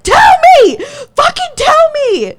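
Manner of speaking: furious scream, demanding the truth. Retold or imitated. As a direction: scream it at him, losing control. Retold screaming at boyfriend.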